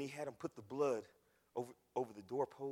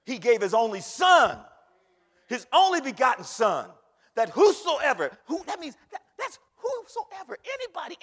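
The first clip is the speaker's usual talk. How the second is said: high, loud, ringing preacher's call; preacher shouting that anybody can be saved; full-force preaching; 11 semitones higher, 18 dB louder than usual. Acted. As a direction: hammer home whosoever to the church.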